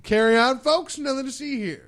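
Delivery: high, loud mock public call; mock crowd dispersal. Co-host jokingly waves listeners on, mock crowd dispersal. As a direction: call out as if moving onlookers along.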